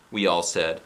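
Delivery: plain reading voice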